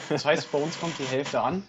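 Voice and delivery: ordinary talk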